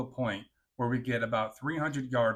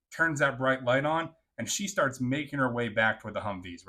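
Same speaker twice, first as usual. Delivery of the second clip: ordinary delivery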